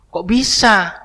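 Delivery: high-pitched puzzled question in local Malay dialect; regional Malay dialect. Acted. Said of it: "kata bahasanya kan". (logat Melayu daerah, heran bernada tinggi)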